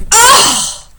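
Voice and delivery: sudden high loud wail; hammed-up bear anguish. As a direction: cry out in mock torment. Reader acts tormented Bear.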